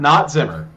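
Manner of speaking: "Not" hit loud and falling; loud "Not" then "Zimmer" falling; stress on not. Shown as a demonstration. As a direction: correct the name by punching "Not". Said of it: "Different, different High Prince".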